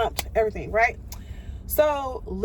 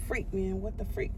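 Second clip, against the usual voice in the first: muttered to herself; 3 semitones lower, 11 dB quieter than usual. Retold or imitated. Inner reaction to tow truck guy.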